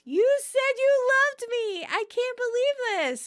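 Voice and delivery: very high, delighted squeal; gushing in a high, sweet voice; sweet yell. A narrator's reading. Monica has heard her boyfriend say love, sweetly yelling. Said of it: "Monica sweetly yells".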